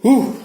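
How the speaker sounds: a high, loud whew of relief; relieved whew. Real, not acted. Maker finally finishing the wiring, loud relieved whew. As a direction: blow it out like a weight is off.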